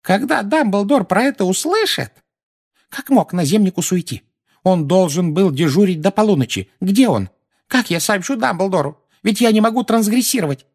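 put-on high, agitated old woman; high-pitched distraught questions; panicked lament. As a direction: fret out loud in rising alarm. Woman panics, wringing her hands.

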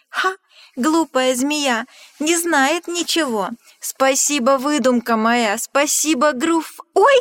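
loud, high triumphant crowing, cut off short; gleeful gloating. Acted. Mouse crowing over the snake, then freezing in fright. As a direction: crow about fooling the snake, then stop dead.

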